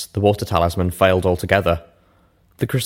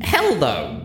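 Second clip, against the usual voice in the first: tipsy denial; 8 semitones higher than usual. A narrator's reading. Rainbow repeats herself.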